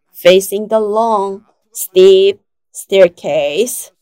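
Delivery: ordinary delivery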